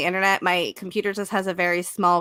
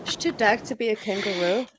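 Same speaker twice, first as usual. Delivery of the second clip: put-on Australian accent; 4 dB quieter than usual. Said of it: "tried to do an Australian accent".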